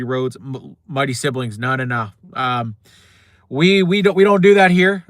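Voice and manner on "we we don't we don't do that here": we don't do that here, shouted high and loud; loud, indignant dismissal; loud catchphrase. Real, not acted. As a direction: bark the catchphrase down the mic. Rejecting the rival, catchphrase.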